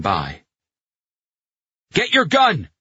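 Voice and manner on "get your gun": urgent high-pitched shout; shouted order. A narrator's reading. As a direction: yell a frantic command, loud and high. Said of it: "Pino shouted at Carletto".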